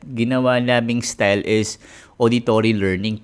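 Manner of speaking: ordinary talk, nothing marked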